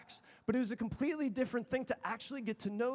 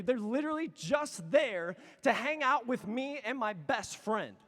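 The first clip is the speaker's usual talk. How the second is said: usual speaking voice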